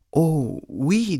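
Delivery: put-on high, lilting little girl. A narrator's reading. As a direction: answer in a high, eager child's voice. Little girl answers the wolf.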